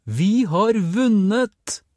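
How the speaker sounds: slow, high, loud cry of joy; excited shout. A narrator's reading. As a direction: call out the big news triumphantly. Boy announces the win. (jublende, langsomt rop)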